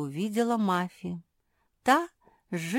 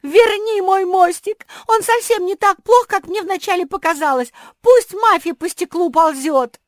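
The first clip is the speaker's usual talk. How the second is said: panicked pleading; 12 semitones higher, 14 dB louder than usual. A narrator's reading. Panicking pug begs.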